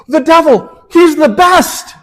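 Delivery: the saint happily springing his surprise on the bishop; joyful exclamation. Retold or imitated. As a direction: beam it out like the best news ever. Saint cheerfully praising the devil.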